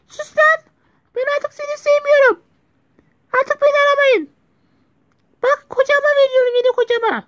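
very high, loud put-on woman's voice; hammed-up falsetto mom voice; mother impression. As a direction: shriek it in a fake high mother voice. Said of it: "İyi taklit yaptım ha".

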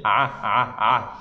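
A squeezed from the throat with a scratch; A scraped at the back of the throat; guttural A; throat ain sound. Shown as a demonstration. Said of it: "Vous voyez là où vous grattez A".

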